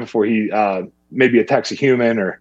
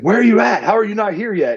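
high-pitched imitation of an exasperated caller; impatient demand; 9 semitones higher than usual. Hunting partner calling the straggler on the hill, impatient. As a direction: retell him badgering, pitch jumping up.

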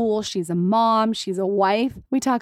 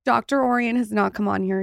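ordinary talk, nothing marked

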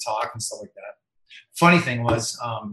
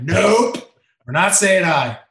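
firm refusal; 5 semitones higher, 7 dB louder, much slower than usual. Retold or imitated. Acted refusal to cousin.

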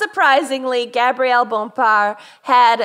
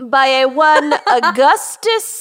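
grandiose; 3 semitones higher, 4 dB louder, much slower than usual. Acted. Grand name announcement.

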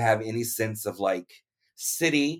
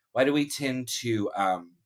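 ordinary delivery

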